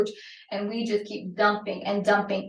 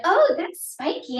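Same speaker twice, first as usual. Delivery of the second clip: put-on child's voice; 9 semitones higher, 4 dB louder than usual. Child voiced touching sand.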